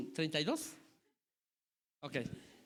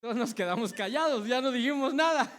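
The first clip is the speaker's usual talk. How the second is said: louder, laughing through the words; 4 semitones higher, 8 dB louder than usual. Real, not acted. Preacher laughs telling the church how the family went silent, laughing loudly. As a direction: laugh it out at the memory.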